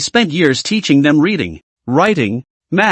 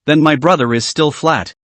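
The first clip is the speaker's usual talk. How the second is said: plain reading voice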